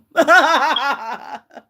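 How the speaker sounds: tearful laugh. Real, not acted. Laughing through tears at son's words.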